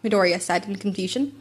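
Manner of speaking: usual speaking voice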